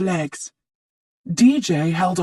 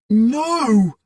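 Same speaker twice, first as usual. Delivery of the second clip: crying out; 5 semitones higher, 4 dB louder than usual. A narrator's reading. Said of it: "cried Chowder".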